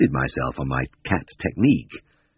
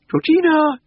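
very high, loud singsong call; carolling call; 6 dB louder, slower than usual. A narrator's reading. Missus Beck calls her cat in the garden, carolling high. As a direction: carol the name out across the garden. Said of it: "caroled Missus Beck".

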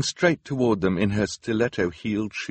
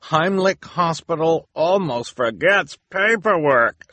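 reporter headline; 11 semitones higher, 7 dB louder, slower than usual. A narrator's reading. Reporter reads headline aloud.

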